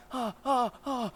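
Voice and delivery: high, loud breath cries; hammed-up high panting; acted gasps. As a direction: gasp in mounting alarm.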